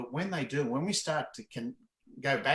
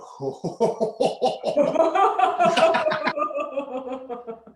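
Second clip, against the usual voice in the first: loud, much higher laugh from the belly; belly laugh demo; 12 semitones higher, 7 dB louder than usual. Shown as a demonstration. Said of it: "and we go".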